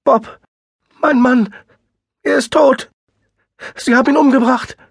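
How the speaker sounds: high, loud sobbing voice; blubbering. A narrator's reading. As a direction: sob it out, falling apart. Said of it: "heulte sie".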